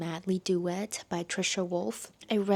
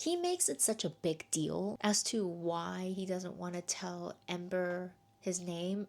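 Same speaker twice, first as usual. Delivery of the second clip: usual speaking voice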